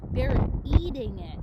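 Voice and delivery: "eating" hit loud and falling; loud, excited "They're eating it"; excited reveal. Real, not acted. Amazed discovery for the kids.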